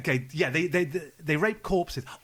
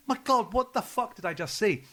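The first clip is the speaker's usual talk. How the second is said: higher-pitched mock amazement; retold astonishment; 7 semitones higher than usual. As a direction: relive the disbelief. Acts out own amazed reaction.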